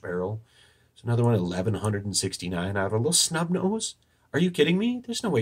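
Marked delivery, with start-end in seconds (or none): 4.3-5.4 sharp high disbelief; incredulous. Real, not acted. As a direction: ask it in flat disbelief. Incredulous aside about recoil.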